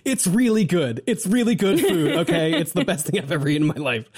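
high, laughing mock food rave; lightly hammed-up food gushing; giving in, gushing. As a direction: drop the excuse and rave about it. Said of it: "He's like".

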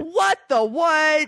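slow, high "what the what"; mock disbelief. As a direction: stretch out the fake shock. Mock disbelief at the episode count.

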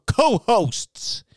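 high-pitched, hooting put-on voice; mock-emphatic correction. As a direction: jump way up high on the word. Correcting to co-hosts with mock pomp.

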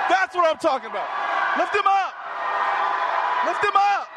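high loud hyped exhortation; preaching at full force. Real, not acted. Preacher urges the crowd to keep shouting. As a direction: fire the crowd up, yelling.

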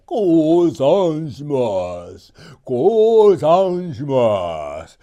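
loud, very slow, begging ogre chief; tearful plea. Acted. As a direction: beg for your life through tears. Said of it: "大粒の涙をボロボロこぼしながら". (泣きながらゆっくり命乞いする鬼の大将)

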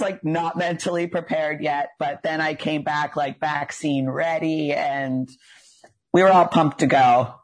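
ordinary talk

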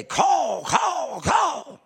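high cawing crow call. Retold or imitated. Crows calling down to the tied crow, cawing. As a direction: caw out like crows flying over. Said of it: "and say".

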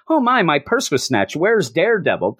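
high, fussy little complaint, lightly hammed up; mock fussy victim. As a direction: parody a whiny purse-snatching victim. Mocking a petty crime victim.

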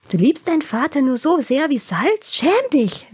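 high, swooping indignation of scolding sisters; scandalized disbelief rising into a reproach; indignant. A narrator's reading. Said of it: "riefen ihre beiden Schwestern empört".

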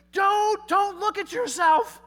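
high, loud, slow urgent plea; the flesh pleading not to look. Acted. The flesh resisting self-examination, high loud plea. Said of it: "inside my flesh goes".

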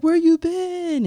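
high, drawn-out retold greeting; excited greeting. Star greets someone he remembers, excited high greeting. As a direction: stretch it out high and loud.

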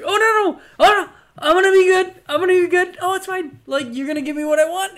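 high, fast, loud pleading; mock panicky Hinkle. Magician caving to Santa, high and fast. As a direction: grovel to Santa in a squeaky rush. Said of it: "he's just like".

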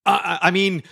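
high-pitched German slip, hastily covered; stumble, then stretch it out in mock-German accent. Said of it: "starts saying something in a German accent".

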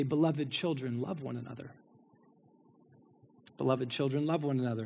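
put-on soft, frail old apostle voice; old John's refrain. Aged John blessing the believers, softly. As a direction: speak it gently like a dying old man. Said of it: "And he'd say".

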